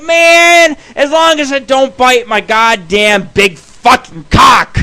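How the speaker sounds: a drawn-out retold yell at the girlfriend; mock yell. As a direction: bellow it like a dare. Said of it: "I'm like".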